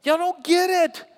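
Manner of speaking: high, loud, pleading call; Jesus rebuking the crowd. Acted. Jesus telling a crowd they miss the point, high and loud. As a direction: press the crowd like a frustrated teacher. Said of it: "Jesus looks at that crowd and says".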